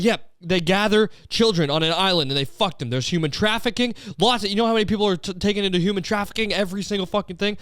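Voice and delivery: worked-up, hammed-up outrage; mock tirade. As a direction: act out an insistent conspiracy ranter. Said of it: "go on this tirade".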